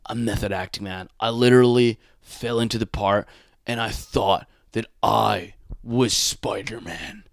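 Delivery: actor bragging about becoming his role; mock method actor.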